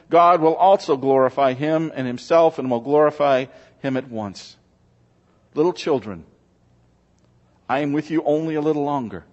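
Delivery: normal talking voice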